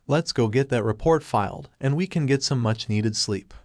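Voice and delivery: plain reading voice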